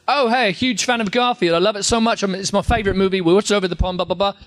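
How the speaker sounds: excited British admirer, imitated; British accent. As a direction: gush in a put-on British accent. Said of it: "someone with an accent, you pick it up".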